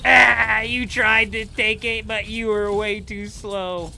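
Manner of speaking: loud, high gloating crow; gloating taunt. Real, not acted. Taunting a rival who was too slow, gloating. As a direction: crow it loudly, slowing on the last words.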